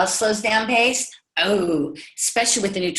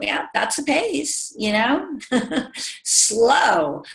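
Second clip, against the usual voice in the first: teasing; 5 semitones higher, much slower than usual. Retold or imitated. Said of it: "I like to tell them".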